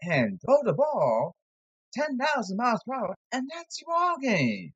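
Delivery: high mocking announcer voice; sarcastic high parody of a baseball call. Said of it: "I'm being sarcastic here".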